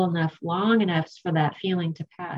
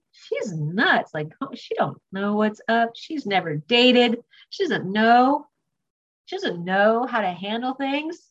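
slow, drawn-out, swooping complaint; mock indignation; 3 semitones higher than usual. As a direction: rant in disbelief, stretching the key words. Acts out old indignation at sponsor.

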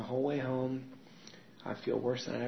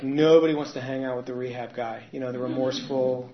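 ordinary talk, nothing marked

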